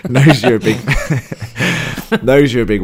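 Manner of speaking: real laughter breaking up the words; laughing. Real, not acted. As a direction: restart loudly through a laughing fit. Cracking up at height joke.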